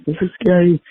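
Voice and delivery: high, mock frightened kid voice; scared kids. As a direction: whine it like a scared little kid.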